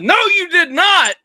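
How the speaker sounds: high, loud gloating retort; triumphant boast. Retold or imitated. Husband gloating to his wife about calling Pennsylvania. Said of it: "And I said".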